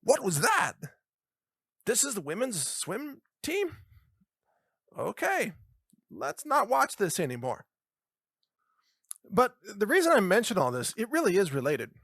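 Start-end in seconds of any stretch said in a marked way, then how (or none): 0.0-0.7 very high, loud cry of disbelief; exaggerated high-pitched shock; mock shocked crowd. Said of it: "everybody will go". 1.9-7.6 raised-pitch pretend dismay; mock bewildered crowd. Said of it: "everybody will go".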